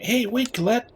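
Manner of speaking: high-pitched companion calling out; calling after someone. Acted. Companion calling after departing Colette, high and urgent. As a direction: call after a friend in a high voice.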